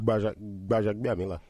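Bajabiamila with popped lips, Nigerian style; Nigerian pronunciation. Shown as a demonstration. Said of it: "Can you pop your lips like that".

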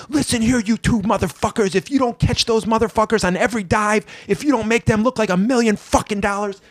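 put-on high-pitched drunken tirade; Sandman. Drunk veteran threatening two new wrestlers, high belligerent tirade. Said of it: "this is me doing my Sandman".

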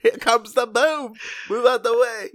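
high, loud calling to clear a path; mock shouting. Boom operator clears the way, high mock shout. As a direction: holler it like a frantic crew member.

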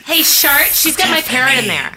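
ordinary delivery

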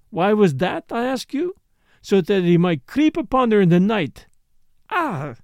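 put-on worked-up accented accusation, emphatic; vehement foreign dancer. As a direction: build the accusation with theatrical relish. Morel's heated accusation.

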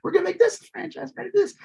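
high, excited studio boss voice; mock studio exec pitching franchises; studio exec impression. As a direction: hype it up like a greedy exec.

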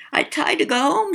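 put-on high, small child's voice with a lisp; a tiny ghost girl, thumb in her mouth; lisping little girl. A narrator's reading. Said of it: "she lisped".

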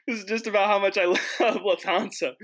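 high, breaking into laughter mid-sentence; cracking up. Real, not acted. Gushing about Lufthansa to listeners, cracking up. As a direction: laugh helplessly through the words.

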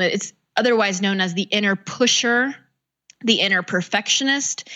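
ordinary delivery

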